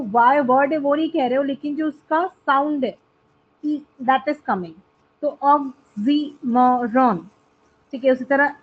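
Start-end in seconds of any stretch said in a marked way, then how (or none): 5.4-7.2 oxymoron drawn out as ox-y-mo-ron; four slow, separated beats on oxymoron; syllable by syllable. Shown as a demonstration. Teacher repeating the example for students, syllable by syllable. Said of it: "four syllables होते हैं".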